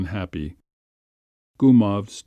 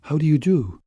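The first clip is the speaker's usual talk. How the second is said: higher, strained attempt at a greeting; mildly trembling, pitched-up voice; nervous, trembling greeting; 7 semitones higher than usual. A narrator's reading. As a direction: greet with a forced smile. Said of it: "in a trembling voice with a forced smile".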